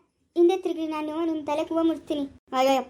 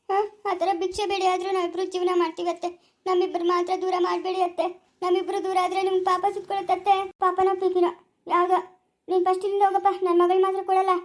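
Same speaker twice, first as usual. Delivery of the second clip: ordinary delivery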